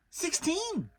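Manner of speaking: squeaky little Piglet, pitched well up; tiny eager piglet; high small-animal voice. A narrator's reading.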